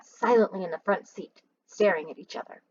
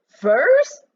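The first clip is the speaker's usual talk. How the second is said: Furs? much higher and louder, rising; cried out; 8 semitones higher, 9 dB louder than usual. Acted. Mac repeats the news, higher, louder and rising. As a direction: shriek Furs? in disbelief, rising. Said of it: "he cried out".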